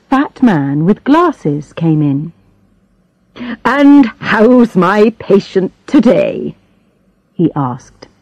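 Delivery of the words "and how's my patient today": slow, hearty bedside manner; breezy doctor voice; jolly doctor. Acted.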